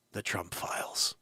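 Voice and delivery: hushed, slow reveal of the title; dramatic whisper. Acted. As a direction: whisper the Trump Files like a secret.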